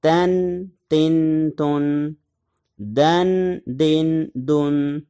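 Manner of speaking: تن and دن repeated with nasal ending; slow recitation of تن and دن; tanween drill. Shown as a demonstration. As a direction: chant تن تن then دن دن slowly. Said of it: "تنوین کی صورت میں".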